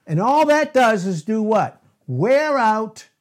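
loud, driving preacher cadence; forceful preaching. Real, not acted. Preacher driving the point home to his congregation, loud and forceful.

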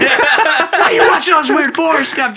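loud laughing outburst; laughing shout. Real, not acted. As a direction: yell it through laughter.

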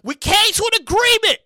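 ordinary delivery

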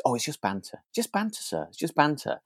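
a hint of a boy shrugging off blame to his teacher; mock schoolboy excuse. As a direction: brush it off like a cheeky pupil caught out. Boys excusing bullying at school.

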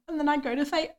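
ordinary talk, nothing marked

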